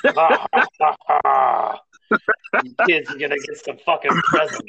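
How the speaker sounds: hammed-up crazy Santa laugh; loud manic Santa voice. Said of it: "you sounded like some kind of crazy Santa".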